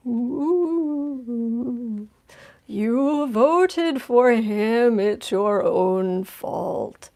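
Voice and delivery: very slow, drawn-out spooky ghost wail; haunting ghost. Acted. Vices haunting senators who voted for Trump, ghostly wail. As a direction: moan it like a mock ghost, stretching every word. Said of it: "like, haunting them".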